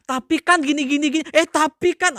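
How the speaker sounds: high, whiny mock excuses; mock the congregant who dodges blame; whiny excuse-maker. Preacher mocking a member who makes excuses, whiny and high. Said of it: "muka-muka Saul keluar tuh".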